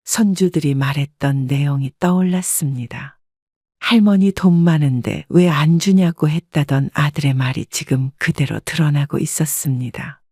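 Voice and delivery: ordinary delivery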